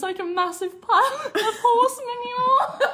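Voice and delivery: helpless high, squeaky laughing through the words; funny-sounding manure. Real, not acted. Storyteller cracking up at her own poo story, helpless laughter. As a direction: barely get it out for laughing. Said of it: "Why did she say manure so funny?".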